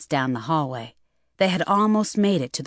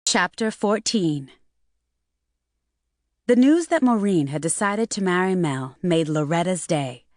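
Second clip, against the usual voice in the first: plain reading voice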